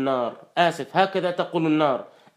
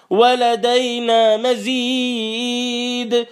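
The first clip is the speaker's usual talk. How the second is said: ولدينا مزيد chanted slowly and pitched higher; climbing recitation; 5 semitones higher, much slower than usual. Acted. Preacher repeating the verse, rising in a higher slow chant.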